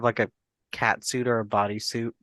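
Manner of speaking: normal talking voice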